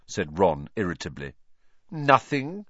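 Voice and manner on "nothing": high, loud and haughty; lofty. A narrator's reading. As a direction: dismiss him loftily. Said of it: "in a lofty voice".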